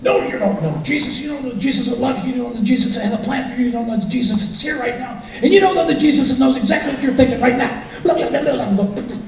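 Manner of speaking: high, fervent rising preacher; preaching pastor. Retold or imitated. Pastor pressing Jesus on John, high and fervent. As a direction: build like a pastor unleashed. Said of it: "this monster comes ripping out".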